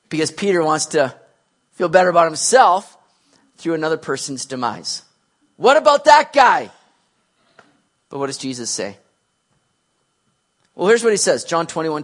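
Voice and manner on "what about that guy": loud, high mock protest; indignant demand. Peter deflects attention onto John, in a loud mock protest. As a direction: call it out, pitch raised.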